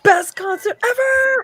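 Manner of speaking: excited. Retold or imitated. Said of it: "Roman's like".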